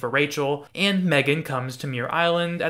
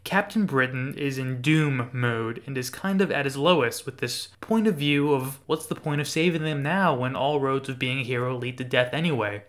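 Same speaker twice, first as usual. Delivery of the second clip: ordinary delivery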